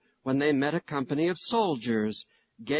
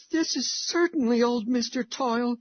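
put-on high, trembling frightened child; whisper-scared boy spotting his teacher; 8 semitones higher than usual. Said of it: "in a trembling voice".

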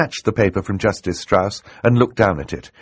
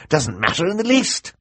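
dismissive villain; 12 semitones higher than usual. Acted. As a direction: voice rising high in scorn. Villain voiced in narration.

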